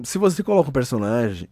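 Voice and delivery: ordinary talk, nothing marked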